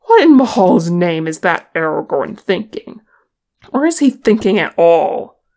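loud, exasperated questions; heated, forceful outburst; indignant. A narrator's reading. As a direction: demand it in disbelief. Indignant dwarf questions Aragorn's judgement.

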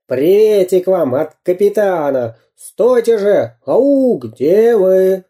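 high, stretched-out calls after runaways; wheedling cartoon bandit hollering; shouting villain spy. A narrator's reading. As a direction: coax and call them back, falsely jolly. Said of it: "кричал Дырка".

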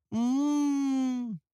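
long, high humming "mmm"; blissful food moan. A narrator's reading. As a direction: hum at length with a mouth shut, savouring food. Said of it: "замычал писарь". (протяжное мычание от удовольствия)

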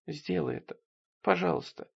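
hushed acted entreaty; pleading. A narrator's reading. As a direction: beg meekly, near tears. Tearful fiancée begging.

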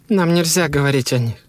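high woman's voice, insisting; insistent wife. A narrator's reading.

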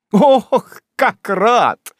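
high, loud, overdone delight; gushing joy. A narrator's reading. Job gushes over meeting Sam, high and loud. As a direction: gush with fake rapture. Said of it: "воскликнул мистер Иов Троттер".

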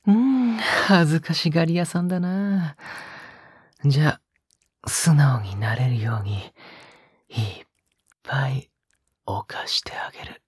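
breathy close-mic whispers with a teasing edge; a lover's hushed, teasing murmur; intimate ASMR whisper. Acted. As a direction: whisper right into the listener's ear, coaxing. Intimate whispered roleplay throughout.

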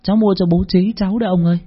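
put-on high, quick child voice; innocent child. A narrator's reading. Little boy telling the shopkeeper about his father, high and innocent. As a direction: chirp it out like an eager little boy. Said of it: "hồn nhiên".

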